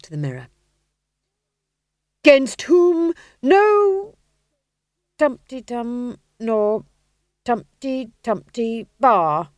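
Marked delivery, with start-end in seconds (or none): 2.2-9.5 very slow, high, loud, unsure stage voice; uncertain hammy Death. Acted. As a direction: fumble the line, humming the gaps. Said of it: "he said uncertainly".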